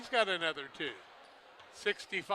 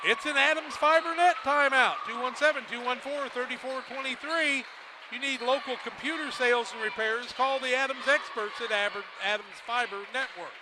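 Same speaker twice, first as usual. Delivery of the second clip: ad read; 4 semitones higher than usual. Acted. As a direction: sell the sponsor like a radio spot. Sponsor ad read during timeout.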